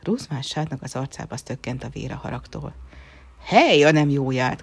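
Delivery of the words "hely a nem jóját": loud furious cry; angry shout. Acted. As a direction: shout in outrage. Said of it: "kiáltotta".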